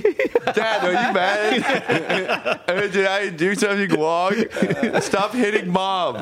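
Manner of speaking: put-on high, slow, pleading child voice; sweet pleading. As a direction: beg like a scared little kid. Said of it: "my puppy dog eyes come through, through my voice".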